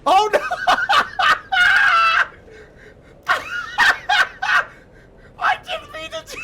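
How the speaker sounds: real high screaming laughter; shrieking laugh. Real, not acted. Streamer shrieking with laughter as the car goes over.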